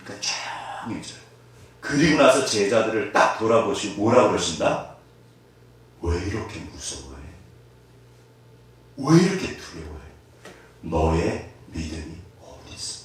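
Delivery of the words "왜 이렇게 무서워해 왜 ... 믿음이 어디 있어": low, soft, tender question; calm the frightened ones softly; gentle rebuke. Acted. Jesus gently rebukes disciples. (낮고 부드럽게 다정히 나무라는 예수님)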